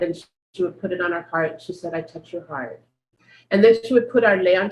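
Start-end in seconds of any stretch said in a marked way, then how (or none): 1.9-2.8 much softer, a tender hush; softly spoken ritual words, much softer; hushed blessing. Retold or imitated. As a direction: say it like a quiet blessing over a dancer. Teacher's blessing ritual retold.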